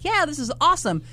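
acted-out holiday excitement; delighted tourist. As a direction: gush like a tourist at a fancy resort. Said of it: "and you're like".

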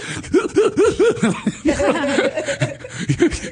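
high burst of laughter. Real, not acted. Laughing at his own puppy impression. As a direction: laugh at your own silly voice.

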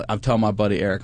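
ordinary delivery

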